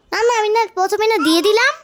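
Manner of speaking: high, fast protest; excited protest. Acted. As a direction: rush out an excited objection. Customer protests quickly.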